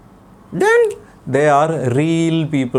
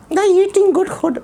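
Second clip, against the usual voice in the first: put-on puppet boy voice. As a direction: play the cheeky little boy puppet. Puppet child voice.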